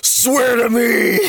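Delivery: high, drawn-out vigilante shout; Batman. Acted. Showing what Batman yells, Bale-style shouted impression. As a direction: roar it slowly, voice straining.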